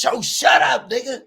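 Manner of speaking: an LA robber hissing at the host to shut up; annoyed LA dude. Retold or imitated. LA robber telling host to shut up, high and loud.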